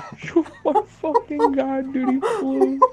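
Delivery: cracking up as the bike flies off; slow, high, loud shriek of laughter; excited disbelief. Real, not acted. Laughing at the kid's crash.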